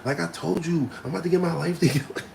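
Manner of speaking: put-on high, fed-up girlfriend; mock fed-up girlfriend. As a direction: scold him as his fed-up girl. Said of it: "she's like".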